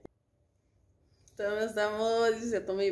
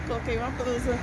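normal talking voice

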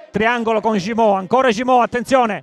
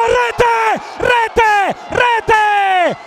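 stretched goal scream, much higher and louder; breathless italian goal commentary; 10 semitones higher, 3 dB louder, much slower than usual. Real, not acted. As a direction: yell the goal, slow and drawn out. Second goal in live commentary.

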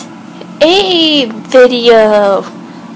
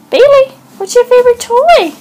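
much higher, sing-song pet voice; 11 semitones higher, 5 dB louder, faster than usual. Real, not acted. Owner asking her dog about its favorite toy, in high baby talk. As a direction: coo the question up high at the dog.

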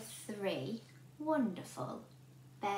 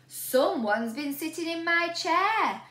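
big, louder bear growl; growling Daddy Bear; 5 semitones higher, 8 dB louder than usual. A narrator's reading. Daddy Bear growling about his eaten porridge. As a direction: growl like a cross papa bear. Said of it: "growled Daddy Bear".

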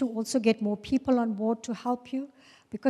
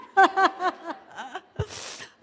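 high, loud peal of laughter; real laugh; 6 semitones higher, 5 dB louder than usual. Real, not acted. Speaker laughs at being a crazy woman, high loud laugh. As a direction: burst out laughing at your own joke.

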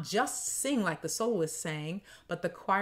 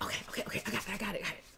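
whispered to myself; 3 semitones higher, 6 dB quieter, much faster than usual. Retold or imitated. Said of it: "I was like".

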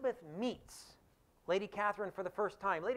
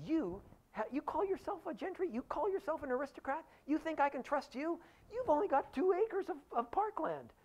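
much higher, shrill-free sneering grande dame; mock outraged aristocrat; 10 semitones higher than usual. Lady Catherine scorns the family's tiny parkland, high and outraged. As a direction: pitch it way up, scandalised and scornful.

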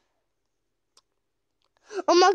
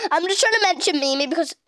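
ordinary delivery

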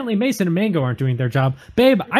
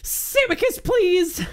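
high, squeaky begging; high-pitched, desperate plea; pleading; 10 semitones higher, slower than usual. Acted. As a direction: plead with your wife, voice shooting up. Pleading with wife to watch Samicus.

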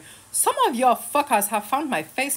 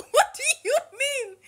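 laughing disbelief. Real, not acted.

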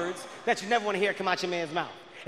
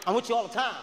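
ordinary talk, nothing marked

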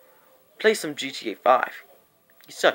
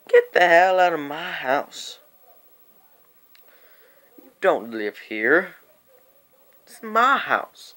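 drawn-out mock outrage; acted angry outburst; 2 semitones higher than usual. Cousin ordering the visitor out, angry. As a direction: act out an irate homeowner, words stretched slow.

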